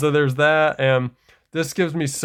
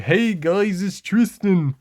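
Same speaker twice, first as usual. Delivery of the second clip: much higher, goofy cartoon-teen voice; mock the old dub's silly Tristan; lampooning the first voice actor's Tristan, high and goofy; 7 semitones higher, slower than usual. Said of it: "his voice was very funny and silly".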